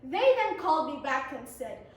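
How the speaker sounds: ordinary delivery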